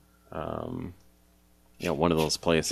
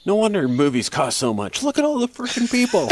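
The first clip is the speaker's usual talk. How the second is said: much higher, louder annoyed grumble; high loud complaining; 6 dB louder than usual. Retold or imitated. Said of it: "'Cause he's like".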